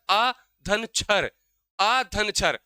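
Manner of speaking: अ धन छर said slowly, each part separated; split into parts. Shown as a demonstration. Teacher splitting the word for students, slow and deliberate. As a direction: break अक्षर into अ, धन and छर, one at a time. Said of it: "मतलब".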